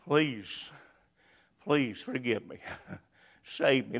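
soft, hushed plea for mercy; quiet pleading. Acted. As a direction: beg softly, almost to yourself. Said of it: "where they'll say".

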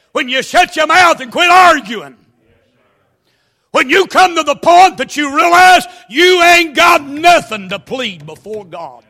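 loud, high shouted preaching; full-force preaching. Acted. Preacher shouting hell at the church. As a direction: thunder it at the congregation.

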